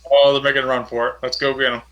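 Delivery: ordinary delivery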